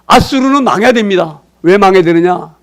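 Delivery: ordinary talk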